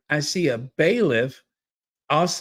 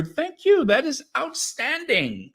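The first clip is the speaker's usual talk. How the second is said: a pitched-up delighted thank you; high excited thanks; 12 semitones higher than usual. Real, not acted. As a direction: lift it high and gush. Thanking new member Dee.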